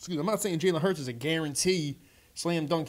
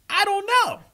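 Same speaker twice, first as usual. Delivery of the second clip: much higher and louder, a baffled yelp; exasperated cry; 9 dB louder than usual. Real, not acted. Fan venting at his team's draft choice, high baffled cry. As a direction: fling it out in disbelief. Ranting about the pick.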